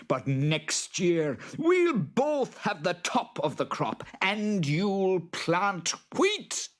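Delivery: high, slow screechy boggart voice; drawn-out, high character voice, grudging then bossy; scheming boggart. A narrator's reading. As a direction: lay down terms as a cross, high-pitched creature.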